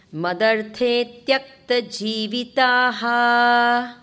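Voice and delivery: slow, loud, drawn-out Sanskrit line; slow recitation. Shown as a demonstration. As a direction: recite each syllable slowly and clearly. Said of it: "Once more".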